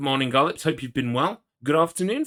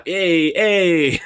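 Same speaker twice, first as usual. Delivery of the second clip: loud, raised call ending on big "hey"s; jokey high-pitched holler, swelling louder; rowdy cheer; 6 semitones higher, 11 dB louder than usual. Pretend. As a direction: belt it out like a playful party shout. Jokey rowdy call-out, then laughs.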